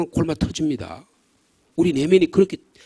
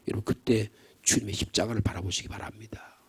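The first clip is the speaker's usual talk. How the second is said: whisper; 7 semitones lower, 11 dB quieter than usual. Acted. Preacher whispers exhortation.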